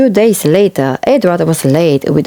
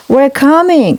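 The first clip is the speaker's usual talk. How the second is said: calling out; 9 semitones higher than usual. A narrator's reading. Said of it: "cried Edward".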